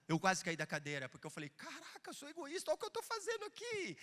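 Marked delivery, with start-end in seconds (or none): 1.5-4.0 high, hushed mock disbelief; small high-pitched astonished voice; acted shock. Acts out own shocked reaction.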